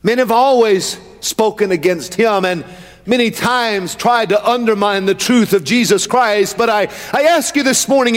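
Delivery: impassioned pulpit delivery, high and driving; fervent high-pitched sermon cadence; full-force preaching. Real, not acted. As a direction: preach it in long rolling phrases.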